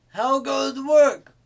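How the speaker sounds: high, loud farmer's holler; farmer calling out. A narrator's reading. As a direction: call it out loudly across the farmyard. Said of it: "called the farmer".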